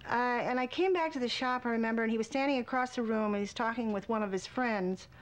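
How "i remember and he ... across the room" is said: normal talking voice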